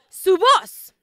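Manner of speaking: preacher calls out su voz, stressing voz; 1st take, called out. Shown as a demonstration. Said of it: "Digan, ellos conocen".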